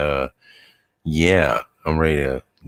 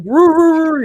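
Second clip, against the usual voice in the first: high, loud mock dog howling "roo, roo"; imitated dog howl; 9 dB louder than usual. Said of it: "He was like, he was like".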